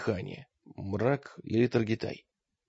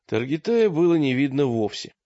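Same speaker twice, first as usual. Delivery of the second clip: ordinary delivery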